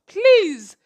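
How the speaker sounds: high, loud, drawn-out begging; emphatic plea. Real, not acted. Host begs listeners for a procrastination cure, loud plea. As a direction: beg for help at full volume.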